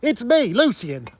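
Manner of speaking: a hint of Cockney, slow on the oi; asking whether Lucian sounded Cockney, in a mock Cockney. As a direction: lightly put on a London Cockney accent.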